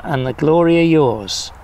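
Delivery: ordinary talk, nothing marked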